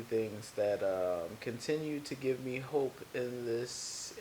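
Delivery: soft, measured public-radio voice; NPR voice. Acted. Podcaster musing on why pets give hope, in a soft NPR voice. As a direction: speak softly, like an NPR host. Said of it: "This is my N P R voice".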